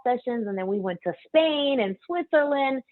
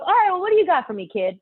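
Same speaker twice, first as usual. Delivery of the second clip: challenging; 9 semitones higher, faster than usual. Retold or imitated. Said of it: "He goes".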